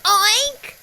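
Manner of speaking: high oink; a squealy pig oink. A narrator's reading. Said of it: "said Pig".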